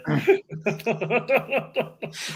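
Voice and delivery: high giggling fit; helpless breathy giggles; hiccup giggles. Real, not acted. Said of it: "I can't stop laughing at Hillary".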